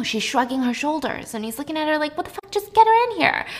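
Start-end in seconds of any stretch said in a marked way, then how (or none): 2.2-3.5 exasperated. Acted. Doctor exasperated with secretary, acted.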